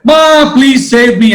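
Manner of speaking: high, loud scream to mom; panicked shout for mom to save her; screaming for help. Acted. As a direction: cry out in terror as she falls. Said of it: "shouted and screamed and said".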